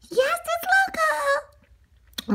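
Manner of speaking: slow, very high singsong reply; sweetly polite. A narrator's reading. Donut reassures man about the call, sugary high. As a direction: draw it out sweetly in a tiny voice.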